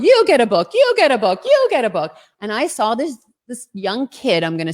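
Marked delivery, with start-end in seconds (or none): 0.0-2.2 Oprah giveaway shout. Retold or imitated. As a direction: announce each giveaway like a talk show host. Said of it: "I wanted to pull an Oprah".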